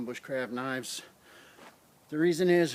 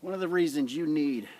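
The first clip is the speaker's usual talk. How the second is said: ordinary delivery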